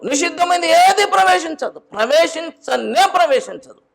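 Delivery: high, loud pulpit proclamation; full-force preaching. Acted. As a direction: preach it out to the congregation.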